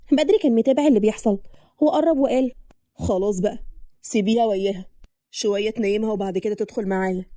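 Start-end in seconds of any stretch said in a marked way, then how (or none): 2.9-7.3 low-pitched male voice; intervening groom. Acted. Groom lets bride settle her daughter first, low-voiced. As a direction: settle it in a lowered, calm voice.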